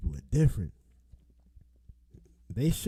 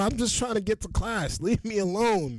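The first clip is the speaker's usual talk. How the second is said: "Leave me alone" yelled high and loud; playing the pushed student, shouting; 7 semitones higher, 3 dB louder than usual. Acting as the student blocked from class, loud high yell. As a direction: bark it to shove them away. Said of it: "That's how I feel".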